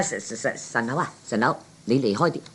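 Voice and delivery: soft, quick hush to a friend; hushed aside. Acted. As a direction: shoo him off quietly before the mark notices. Whispering companion to step away.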